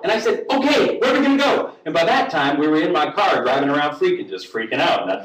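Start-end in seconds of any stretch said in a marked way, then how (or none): 0.5-1.7 high, rushed, flustered question; panicked agreement. Retold or imitated. Storyteller answers Joe's call to flee, rushed panic. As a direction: fire it back in a hurry.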